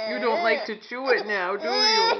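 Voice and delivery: loud, teasing fake-cry to the baby; mock crying. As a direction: pretend to sob along with the baby. Soothing upset baby, mock crying.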